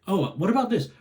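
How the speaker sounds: light, high curious question. Shown as a demonstration. Showing a parent a curious tone. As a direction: ask it with pure interest. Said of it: "Well, listen to my tone".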